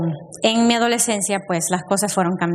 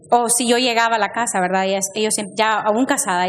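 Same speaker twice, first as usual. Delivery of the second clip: ordinary talk, nothing marked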